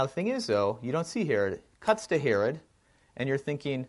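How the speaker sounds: ordinary delivery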